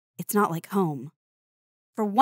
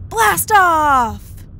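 big loud cheer, pitched way up; thrilled launch yell; excited shout; 9 semitones higher, 10 dB louder than usual. Acted. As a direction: shout it like the rocket just launched. Rocket launch in the story.

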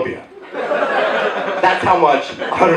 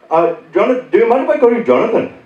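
put-on polite Indian-accented telemarketer; rising, quick request in an imitated Indian accent; mock Indian caller asking permission; 6 semitones higher, 3 dB louder, faster than usual. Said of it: "most of them are Indian".

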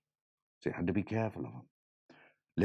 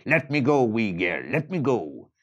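demanding leprechaun; 3 semitones higher, 7 dB louder than usual. Acted.

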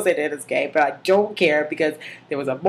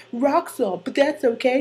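Sebastian; 8 semitones higher than usual. Acted. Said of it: "And he's like".

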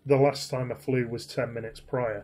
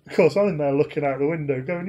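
ordinary delivery

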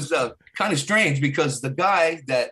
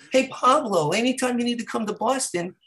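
lightly imitated comedian, higher pitch; higher-voiced retold invitation; comedian impression; 8 semitones higher than usual.

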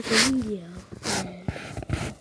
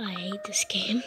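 whispering. Real, not acted. Whispered aside.

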